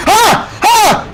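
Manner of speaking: two short high yelps; startled yells. Real, not acted. Gamer attacked suddenly, short high yells. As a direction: yelp twice, pitched high.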